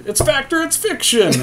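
high lecturing voice, hammed-up; mock lecture voice. Player mock-lectures his teammate about myths, hammed-up. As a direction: play a preachy teacher reciting a lesson. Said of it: "and we say".

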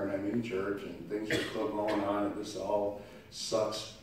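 low, soft and weary, half to himself; the voice of someone at their wit's end; worried adult muttering. Retold or imitated. Preacher voicing a worried churchgoer, low and defeated. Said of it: "looking out the window saying".

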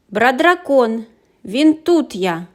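loud, slow proud answer; dragon name. A narrator's reading. Green dragonling answering the roll, loud and slow. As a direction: announce yourself proudly.

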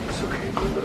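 low, soft reassurance; gently calm a pet, dropping low and quiet; tender, hushed comfort; soothing. Real, not acted. Soothing his pet monkey.

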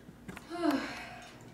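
tired, breathy sigh after the set; heavy exhale. Real, not acted. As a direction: blow out air after effort. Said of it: "Catch your breath".

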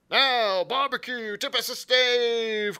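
high, loud sailor's holler; crying out. A narrator's reading. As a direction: yell to a shipmate for a song. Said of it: "cried one voice".

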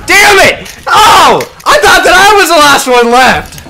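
loud, high yell of dismay; frustrated shout. Real, not acted. Gamer who thought he was the last one left, frustrated shouting. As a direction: cry out at a round suddenly lost. Outburst on dying in game.

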